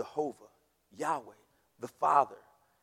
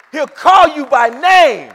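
each word slow and shouted out, much louder; loud slow proclamation; 7 semitones higher, 22 dB louder, slower than usual. Acted. Pastor proclaiming to the congregation, loud and slow. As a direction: proclaim it to the back row. Whoa, that's big.

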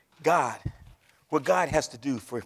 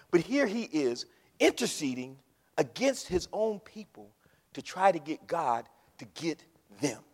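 ordinary talk, nothing marked

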